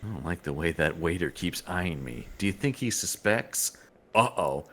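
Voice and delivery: low, quiet, wary aside; hushed suspicion. Acted. Leia confiding about a watching waiter, hushed and suspicious. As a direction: confide it under your breath, lightly acted. Acting out Leia's nervous suspicion.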